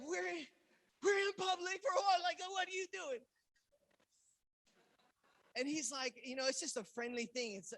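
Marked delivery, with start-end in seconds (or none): 0.1-3.2 loud, high-pitched shocked outburst; alarmed protest. Retold or imitated. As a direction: recoil and protest loudly in mock horror. Startled protest at reaching hand.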